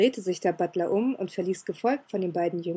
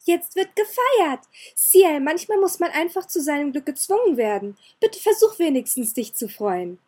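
put-on gleeful girl; 7 semitones higher, 6 dB louder than usual. Elizabeth tossing petals to start the party, gleeful. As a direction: throw the party open with delight. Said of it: "Sie strahlte ihn an".

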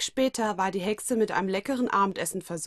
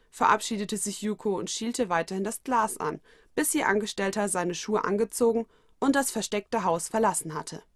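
ordinary delivery, nothing marked

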